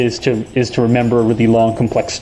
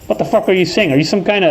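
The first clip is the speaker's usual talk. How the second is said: higher, mock-baffled outsider; 6 semitones higher, faster than usual. Outsider confronts someone talking funny, mocking outsider. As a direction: challenge them in a higher acted voice. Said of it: "Or two".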